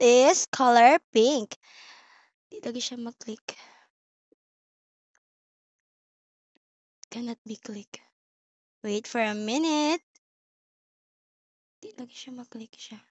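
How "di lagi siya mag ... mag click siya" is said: low quick muttering to herself; muttered aside. Real, not acted. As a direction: mutter under your breath about the glitch. Muttered aside about the click.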